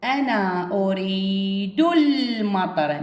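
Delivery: أريد المطر drawn out slowly, ال not sounded; very slow reading. Shown as a demonstration. Teacher shows silent hamzat al-wasl to pupils, very slow. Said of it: "شوفوا لفظ الجملة".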